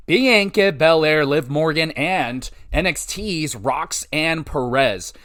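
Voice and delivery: normal talking voice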